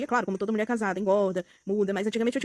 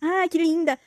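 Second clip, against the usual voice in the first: fake sweet compliment; 6 semitones higher, 3 dB louder, much slower than usual. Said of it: "iriam pagar de boas pessoas".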